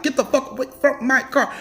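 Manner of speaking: talking with horn. Retold or imitated. Driver talking with her horn to the child, honking warning. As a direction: make the words beep like a car horn. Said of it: "I'm talking with my horn".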